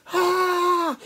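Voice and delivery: loud, high mock scream; hammed-up scream of alarm; terrified workers' scream. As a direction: shriek and flee in pretend panic. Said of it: "and the workers go".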